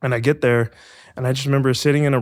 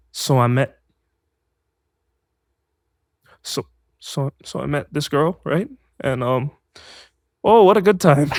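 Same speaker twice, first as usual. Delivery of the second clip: botched different-tone read; 3 semitones higher than usual. Retold or imitated. Actor thrown by a request for another tone, halting then overdone. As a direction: stumble through, then overdo the last line. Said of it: "read that in a different tone".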